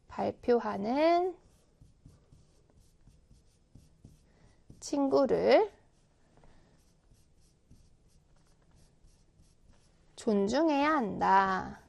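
발표하는 drawn out slowly, 친구를 and 존중해야 한다 after long pauses; slow, spaced dictation. Shown as a demonstration. Teacher dictating to the class, slow and spaced. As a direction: dictate it slowly so pupils can write.

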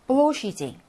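"blow sheet" slow, long stressed "blow"; model reading. Shown as a demonstration. Teacher rereads for students, slow "blow sheet" stressed on "blow". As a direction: stretch "blow", reduce the vowel in "sheet". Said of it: "다시 한번 읽어볼게요".

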